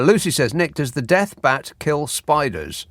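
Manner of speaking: ordinary talk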